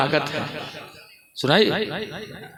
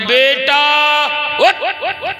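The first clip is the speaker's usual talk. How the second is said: high, rising wake-up call; 9 semitones higher, much slower than usual. Retold or imitated. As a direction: call sharply to rouse a sleeper, imitated. Mother rouses sleeping son once more. (ऊँची आवाज़ में जगाने की पुकार)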